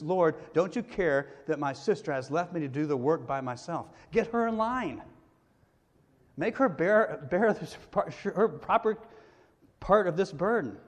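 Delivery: whiny, rising demand to get her sister in line; Martha complaining. Acted. Martha, fed up with her sister, whining to Jesus.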